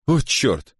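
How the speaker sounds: high, understated groan; mildly groaned oath. A narrator's reading. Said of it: "простонал тридцатилетний Фрэнсис Покок".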